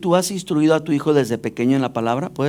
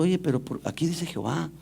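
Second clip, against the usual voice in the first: quiet retold question; 10 dB quieter than usual. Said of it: "Yo le pregunto".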